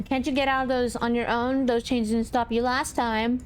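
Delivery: normal talking voice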